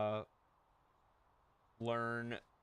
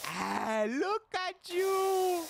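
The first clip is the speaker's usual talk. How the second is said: much higher, louder, slow doting gush; delighted exclamation; 5 dB louder, much slower than usual. Retold or imitated. Italian owner gushing over the tourist, much higher, louder, slow. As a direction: fawn over him like a proud grandpa.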